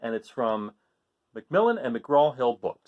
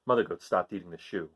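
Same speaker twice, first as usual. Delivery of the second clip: plain reading voice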